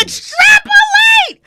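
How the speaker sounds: shrieks the word high and slow, thrilled; ecstatic shout. Real, not acted. Host hearing a new word, a delighted high shriek.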